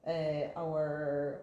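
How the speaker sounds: ordinary delivery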